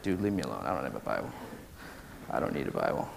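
mock a congregation that wants to be left alone; reluctant crowd voice. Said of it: "Everybody's like".

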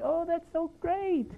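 very high, slow, syrupy mom voice; imitating his mom. The mom gushes over the new roommate in a very high, slow, syrupy voice. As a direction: stretch it out sweetly, way up high. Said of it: "And he's like".

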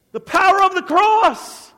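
loud, high, fervent proclamation; full-force preaching. Real, not acted. Preacher declaring the power of the cross, fervent.

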